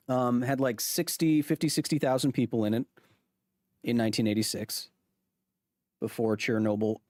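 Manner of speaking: ordinary delivery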